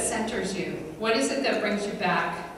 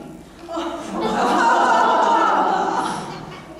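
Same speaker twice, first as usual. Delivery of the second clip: burst of laughter; 12 semitones higher, 4 dB louder than usual. Real, not acted. Audience laughs at punchline.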